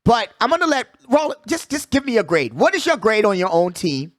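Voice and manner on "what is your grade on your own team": ordinary talk, nothing marked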